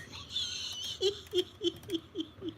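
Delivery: soft breathy laugh under breath; whispered laugh. Real, not acted.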